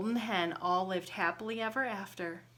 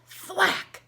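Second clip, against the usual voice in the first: high, loud smack sound; axe thwack; 10 semitones higher, 8 dB louder than usual. A narrator's reading. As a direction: hit it like an axe blow.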